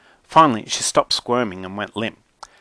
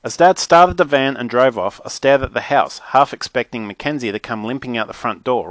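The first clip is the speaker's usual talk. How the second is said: ordinary delivery, nothing marked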